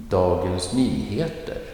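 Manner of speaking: Nyheter lifted, melodic and emphasized; stress on Nyheter. Shown as a demonstration. Teacher models a newspaper name, stress on Nyheter. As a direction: lean on the second word, Nyheter. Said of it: "second part will always get the emphasis".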